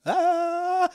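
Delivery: high, wailing mock viewer; whiny complaint. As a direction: squeal like a fed-up fan. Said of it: "vous avez tous fait".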